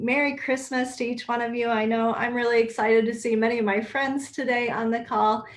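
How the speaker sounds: ordinary delivery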